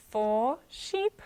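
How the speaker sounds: ordinary delivery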